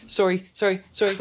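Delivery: gushing sorries like a polite Canadian; apologetic Canadian. Retold or imitated. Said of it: "trying to interject".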